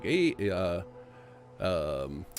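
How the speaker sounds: light mock Canadian accent, eh at the end; phony Canadian accent. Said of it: "phony Canadian accent".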